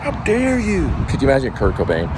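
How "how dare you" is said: high-pitched indignant outburst; outraged scolder. Acted. Someone scolding a person for filming, outraged. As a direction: put on a high, scandalised voice. Acted outrage at being filmed.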